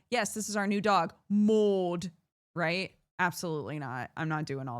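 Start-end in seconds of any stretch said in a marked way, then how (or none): 0.1-2.1 mock English owners. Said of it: "They're like".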